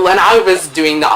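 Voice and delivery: normal talking voice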